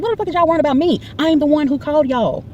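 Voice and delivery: high-pitched, outraged challenge; high, exasperated retort; indignant protest. Retold or imitated. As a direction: act out protesting being treated as the suspect. Retold outburst at the police.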